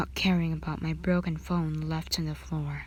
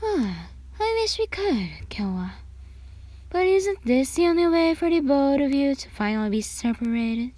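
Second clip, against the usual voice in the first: higher, mock-sweet sneer from the mother; gloating mother; 8 semitones higher, 4 dB louder than usual. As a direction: purr it with a nasty smile. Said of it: "with a disgusting smile on her face".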